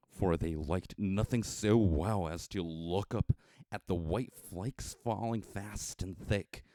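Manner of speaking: usual speaking voice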